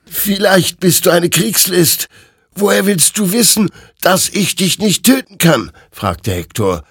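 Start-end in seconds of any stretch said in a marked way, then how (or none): 0.1-5.7 much higher, louder defiant question; desperate soldier. Acted. Hektor questions the rider, high and desperate. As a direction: push back in panic.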